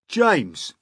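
high, loud call; Centipede's voice. A narrator's reading. Centipede addresses James, brash. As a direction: hail him as a brash bug.

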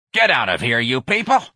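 loud snarl, leaping high at the end; cranky snarled dismissal. A narrator's reading. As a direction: snarl them out of the room, loudly. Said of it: "Miss Trixie snarled".

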